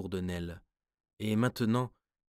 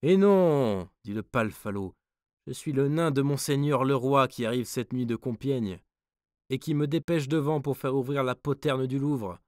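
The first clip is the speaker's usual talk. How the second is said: impish dwarf; 5 semitones higher, 7 dB louder than usual. A narrator's reading. Dwarf answering the guard.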